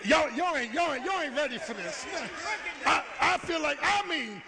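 high, loud testifying; preacher testifying at a shout to the church; full-force preaching. Real, not acted. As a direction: build and shout it out.

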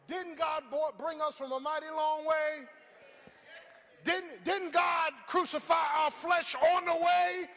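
shouted, high and slow, building praise; climactic preaching. Acted. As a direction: roar it to the rafters.

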